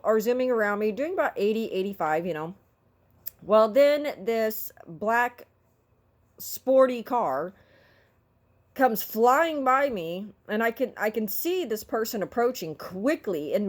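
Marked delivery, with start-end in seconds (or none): 3.4-10.3 drawn-out, deliberate telling; slow down for suspense; slow suspenseful storytelling; slow dramatic buildup. Real, not acted.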